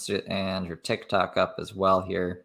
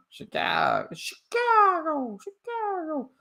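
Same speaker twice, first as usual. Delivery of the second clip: very high, drawn-out mock cry; mocking falsetto; slower than usual. Streamer making fun of her Chicago plan, mocking falsetto. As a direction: stretch the city name out in a squeaky falsetto.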